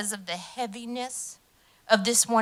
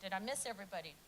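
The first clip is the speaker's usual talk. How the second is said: usual speaking voice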